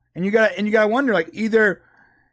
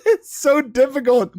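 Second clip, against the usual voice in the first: ordinary talk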